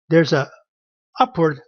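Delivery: ordinary talk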